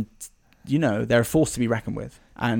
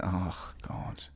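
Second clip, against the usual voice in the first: quiet, exasperated under the breath; annoyed mutter; 4 semitones lower, 11 dB quieter than usual. Acted. Dustin grumbling when Steve calls on the walkie, soft annoyed mutter. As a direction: grumble it so nobody hears.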